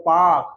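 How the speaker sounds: पार्क as one long, low "paak"; correct pronunciation. Shown as a demonstration. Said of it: "language को सही करो". (पार्क का सही उच्चारण, नीचे सुर में "पाक")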